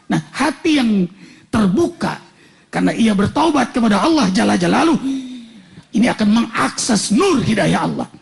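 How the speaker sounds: normal talking voice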